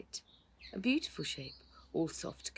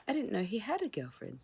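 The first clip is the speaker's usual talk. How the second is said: cautious; 6 semitones higher than usual. A narrator's reading. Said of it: "said Rose cautiously".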